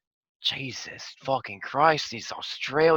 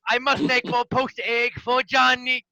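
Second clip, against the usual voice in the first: slow, high, hammed-up Italian; Mario Italian accent; 8 semitones higher, slower than usual. Player voices Tank as Mario, slow cartoon Italian. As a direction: go full cartoon plumber, Italian. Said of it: "Tank's accent?".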